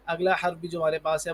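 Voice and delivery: usual speaking voice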